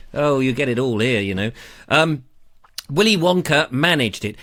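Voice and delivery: ordinary delivery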